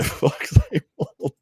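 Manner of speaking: drawn out through a chuckle, Fox Eight Ball; slow, laughing name. Shown as a demonstration. Reading a viewer's joke name aloud, slow and laughing. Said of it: "another funny name you're gonna try and get me to say".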